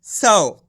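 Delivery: सौ said with a clear au; pron demo. Shown as a demonstration. Teacher demonstrates सौ for students. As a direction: model सौ crisply. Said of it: "सा से क्या हो जाएगा?".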